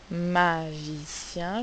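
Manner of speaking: "magicien" stretched slowly over three syllables; slow teacher's sounding-out of "magicien". Shown as a demonstration. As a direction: draw out each syllable of "magicien". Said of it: "trois syllabes".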